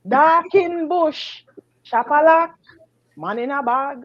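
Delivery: high, drawn-out chant in broken English; mock Jamaican Chinese man calling out slowly. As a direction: imitate his cry, each phrase set apart by pauses. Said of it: "it's like a Jamaican Chinese man".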